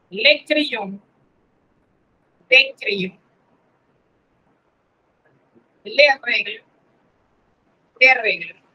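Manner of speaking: "Le crayon", "de crayon" with careful closed vowels; teacher drills "le" and "de" one by one; French articles drilled. Shown as a demonstration. Teacher models French articles for students to copy. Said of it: "Fíjense cómo suena".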